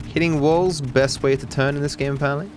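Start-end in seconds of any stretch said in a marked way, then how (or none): none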